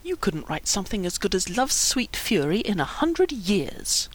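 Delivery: soft, breath-heavy contempt; quiet, cutting put-down; scornful hiss. A narrator's reading. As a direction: dismiss him under your breath. Lydie scorns him and leaves.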